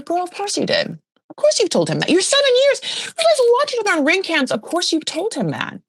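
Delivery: high-pitched, exasperated call-out; mock-outraged squawk at a friend; incredulous scolding. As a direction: call out an obvious lie in disbelief. Acted incredulous scolding of Sheena.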